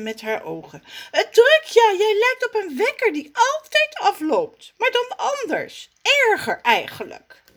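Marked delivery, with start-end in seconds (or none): 1.1-7.4 high, loud exasperated voice; cat Zaza. Acted. Zaza the cat mocks Raf, high and exasperated. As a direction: sneer at him, high and sharp. Zaza's voice.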